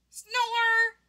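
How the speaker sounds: high, drawn-out "snore"; abrupt cartoon snore; sudden snore. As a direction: blurt out a long high snore. Sleeping character snores.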